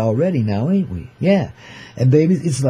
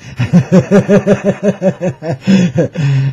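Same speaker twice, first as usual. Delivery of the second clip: a long, louder cackling laugh; big laugh; 4 semitones higher, 4 dB louder than usual. Real, not acted. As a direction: crack up at your own joke. Laughs at cowboy gourmet joke.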